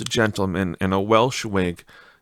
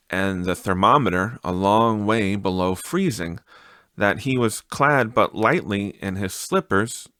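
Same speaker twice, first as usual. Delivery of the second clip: usual speaking voice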